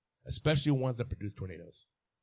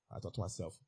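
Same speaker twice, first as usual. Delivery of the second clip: ordinary talk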